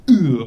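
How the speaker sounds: one short loud grunt; a loud split-second E-40 grunt. Pretend. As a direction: bark a single loud grunt as E-40. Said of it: "Split second impression of E forty".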